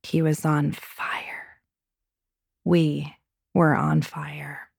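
slow breathy whisper. A narrator's reading. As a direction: breathe the words out slowly. Intimate scene, read unvoiced.

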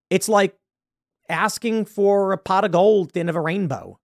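ordinary talk

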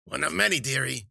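high, loud crone voice, sweetly cruel; cackling old witch. A narrator's reading. Witch answering Wheeler before pouring into the wound, high loud crone. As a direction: purr dearie like a wicked hag. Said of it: "she said".

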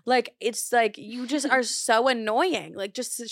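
normal talking voice

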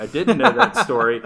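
breaking into a laugh on the quip; amused retold comeback, laughing. Retold quip to stranger on plane.

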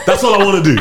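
ordinary talk, nothing marked

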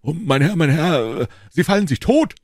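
high, loud wailing warning; panicked scream. A narrator's reading. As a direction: cry out in fright for your master. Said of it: "schrie Planchet".

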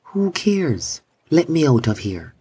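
high, cheeky sun voice; a careless sun that wants out; warm beaming sun voice. A narrator's reading. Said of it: "said the sun".

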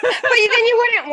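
high through laughter; laughing. Real, not acted. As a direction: laugh while speaking.

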